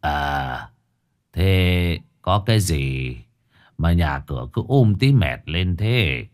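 put-on low, slow old man's voice; old shaman. A narrator's reading. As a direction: greet them as an unhurried old shaman. Old shaman arrives and greets.

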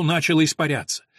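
ordinary delivery

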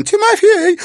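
very high, very loud barked name. Acted. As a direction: snap his name out hard. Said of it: "рявкнула Карина".

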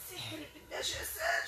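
distant, hushed possessed voice; thick strong voice. Real, not acted. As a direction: murmur it like a spirit speaking through her. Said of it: "بصوت غليظ قوي".

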